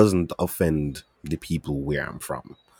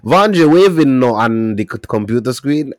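ordinary talk, nothing marked

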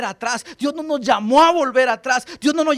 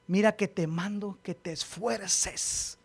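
hushed command; 2 semitones lower, 9 dB quieter, slower than usual. Acted. Pastor quietly repeats God's command to Joshua. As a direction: drop to a near hush.

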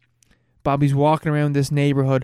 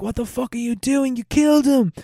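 put-on horrified girlfriend; 9 semitones higher than usual. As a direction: shriek it like she just watched a murder. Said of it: "She's like".

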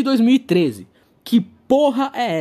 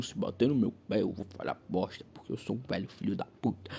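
low, quiet grumble; grumbling old man; 11 semitones lower, 13 dB quieter, faster than usual. Retold or imitated. Old man grumbling at skaters passing by. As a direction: mutter like a grumpy old man. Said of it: "ele já começa a resmungar".

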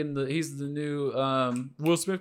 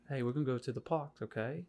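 much softer, gently put-on British; soft-spoken British accent; 9 dB quieter than usual. Shown as a demonstration. Demonstrating British to friends, soft-spoken British accent. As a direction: speak softly in a rough British accent. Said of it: "British accents are really soft-spoken".

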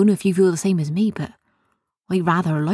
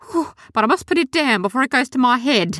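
dazzled chemist; 7 semitones higher, faster than usual. Acted.

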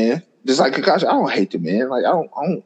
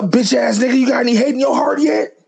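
mock Itachi taunt; 9 semitones higher than usual. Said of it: "he's like".

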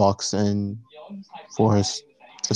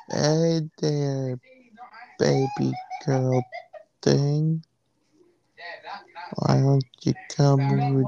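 unconfident and embarrassed; 4 semitones higher than usual. Retold or imitated. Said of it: "the most unconfident, very embarrassed, just, like, no emotion".

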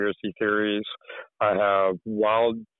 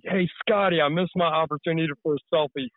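eager call-out; 6 semitones higher than usual. Retold or imitated. Acting out calling to friend.

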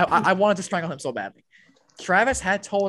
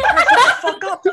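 very high, loud squawk; retold yell; 12 dB louder than usual. Storyteller silencing classmate before the officer, retold high exasperated yell. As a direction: screech it in exasperation. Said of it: "I yelled at him".

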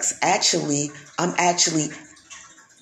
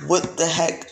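ordinary delivery

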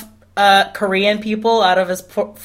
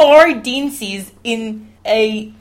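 slow, stretched mock Australian vowels; Australian accent; 3 semitones higher, much slower than usual. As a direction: draw it out like a parody Australian. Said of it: "two of them are Australian".

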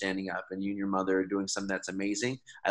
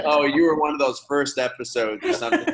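higher, louder, offhand voice; breezy future host; 6 semitones higher, 8 dB louder than usual. Pretend. Guest imitating host in the future, mock offhand. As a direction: play the famous host brushing it off. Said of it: "she's gonna be like".

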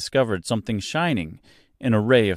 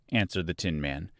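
ordinary delivery, nothing marked

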